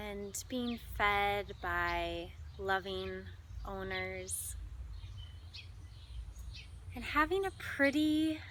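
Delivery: measured delivery with long, spacious pauses; guided meditation. Acted. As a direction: lead listeners gently through imagery, pausing between phrases. Leads animal imagery meditation.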